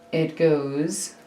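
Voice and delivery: usual speaking voice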